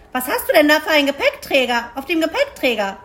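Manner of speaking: high, quick, loud questioning; crow calling out. Acted. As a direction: fire off a nosy crow's question. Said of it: "rief sie".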